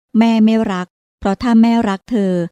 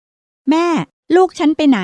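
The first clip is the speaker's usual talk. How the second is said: calling out; 7 semitones higher, much slower than usual. Acted. Daughter calls to her mother.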